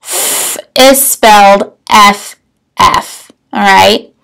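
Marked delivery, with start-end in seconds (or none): 0.0-0.6 "f" as a long pure hiss of air, no vowel; letter sound. Shown as a demonstration. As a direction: hold the bare sound of "f", not the letter name. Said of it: "if we hear that f sound".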